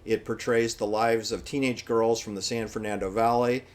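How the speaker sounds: normal talking voice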